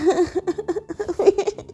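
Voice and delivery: high, drawn-out fake bawling; mock crying wail. Maya threatened with a slap, mock bawling. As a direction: wail like a thwarted child. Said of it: "अभी रोना बंद करो".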